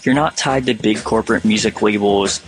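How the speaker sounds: normal talking voice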